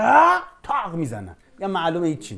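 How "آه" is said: sudden high, loud "ah!" yell; loud startled battle cry; fighter's shout. Retold or imitated. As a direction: blurt a scared brawler's yelp. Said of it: "طرف اولش بگه".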